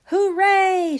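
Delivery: loud happy cheer; a big excited hooray; shout. Acted. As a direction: shout it out with joy. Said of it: "shouted Morty".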